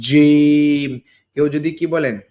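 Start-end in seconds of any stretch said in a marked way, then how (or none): 0.1-0.9 জিম much louder with a push; emphatic model জিম; firm jim. Shown as a demonstration. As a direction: punch out জিম. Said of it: "এর মতো উচ্চারণ হয়".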